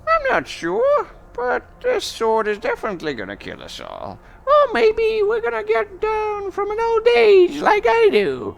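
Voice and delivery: put-on very high-pitched old crone voice; Greta. Old seer reads another bone for the chieftain, high crone voice. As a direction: squeak it out high as an aged seer. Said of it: "Greta is looking at another bone, and she said".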